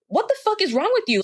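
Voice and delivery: fast, very high-pitched outrage; indignant outburst. Retold or imitated. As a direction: demand it in shrieky disbelief. Retold outrage at the man.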